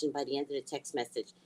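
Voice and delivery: normal talking voice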